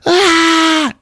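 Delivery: very high, loud wail; piercingly high grief scream; mock anguished cry. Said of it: "her cry".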